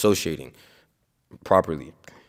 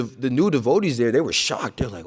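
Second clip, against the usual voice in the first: ordinary talk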